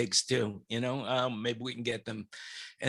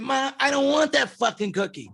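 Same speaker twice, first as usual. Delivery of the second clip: put-on rude kid; 9 semitones higher, 3 dB louder than usual. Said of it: "I said".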